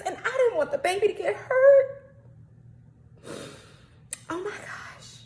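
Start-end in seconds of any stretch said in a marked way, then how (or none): 4.3-5.2 breathless whisper, softer; whispered oh my gosh. Real, not acted. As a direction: let it out as a hushed whisper. Overwhelmed after puppy story.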